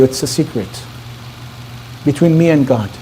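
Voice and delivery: soft, low, reluctant reply; confiding secret. Retold or imitated. Bride refusing to tell her new husband, quietly.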